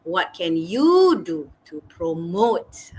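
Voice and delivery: slow question, "promote" stretched and weighted; stress on promote. Shown as a demonstration. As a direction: draw out "you" and land firmly on "promote". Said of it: "stress on the word promote".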